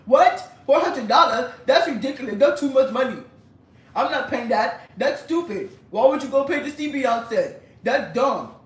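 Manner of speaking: outraged scoff. Retold or imitated. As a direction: scoff like it's ridiculous money. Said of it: "He like".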